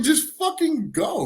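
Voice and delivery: high, drawn-out mock plea; retold frustrated outburst; exasperated urging. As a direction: urge them away, slow and high. Exasperated thought at the woman.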